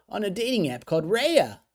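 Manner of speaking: loud, high-pitched boasting as an excited daughter; acted kid voice bursting with pride; proud announcement. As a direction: keep the kid's thrilled reveal going, easing slightly. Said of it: "her face beaming with pride".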